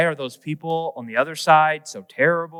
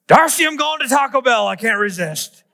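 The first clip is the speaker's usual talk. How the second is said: high, loud mock surrender shout; a hammed-up cry of defeat; giving in; 7 semitones higher, 3 dB louder than usual. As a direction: call out to Darcy, caving in on purpose. Giving in to Taco Bell.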